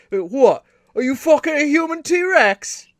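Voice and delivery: high, loud hammed-up fake British voice; bad mock British accent. Hosts mocking an American take on British, loud bad British accent. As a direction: camp it up as an American faking British. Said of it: "the worst accent possible".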